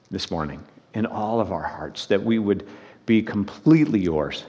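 soft, low, earnest prayer voice. Real, not acted. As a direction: pray quietly and reverently before the sermon. Pastor leads the congregation in prayer.

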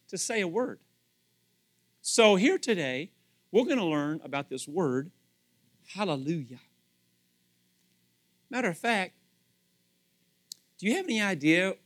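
usual speaking voice

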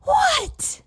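put-on very high, child-like what; eager little boy. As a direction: squeak it like an eager toddler. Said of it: "And he said".

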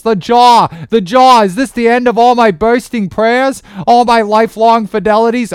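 high, loud frantic cries; panicked first mate. Acted. Starbuck to helmsman and God as the whale charges, panicked shouting. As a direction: shout orders that turn into desperate prayer.

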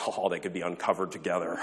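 low, hushed mock-juvenile titter. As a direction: lightly imitate a giggly middle schooler. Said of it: "our junior high minds are like".

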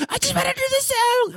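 high, whining plea; mock her crying voice, high and thin; sobbing little-girl whine; whiny Carly. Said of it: "And Carly was like".

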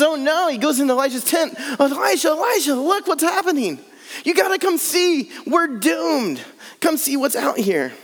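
high, frantic cry of alarm; a terrified servant begging his master to come look; panicked servant. Acted. Servant begs Elisha to see the army, in high panic.

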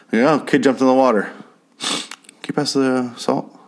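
mock bored dinner guest ignoring the drama; deadpan indifference. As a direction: ask as if nothing just happened. Imagined callous diner at eel dinner.

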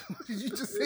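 high laughing disbelief. Real, not acted. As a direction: ask it while cracking up. Laughing shock at the virus joke.

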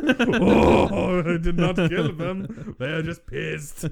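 slow, hammed-up French accent. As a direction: protest in a drawn-out mock French accent. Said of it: "The French accent".

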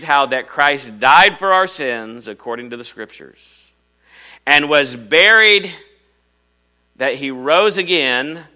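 loud, declaiming scripture read. Acted. Preacher reading First Corinthians aloud to the congregation, declaiming. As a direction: proclaim the verses from the pulpit.

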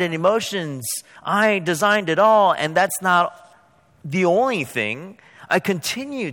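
high, loud, ringing preacher voice as God; preaching at full force. Acted. Preacher voicing God to Moses, loud and soaring. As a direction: proclaim it like God thundering to Moses.

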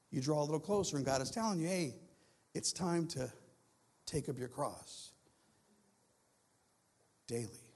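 low, soft, measured preaching; solemn preaching. Real, not acted. Preacher passes on God's call to the congregation, solemn. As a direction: bring it right down, letting the last word fall away.

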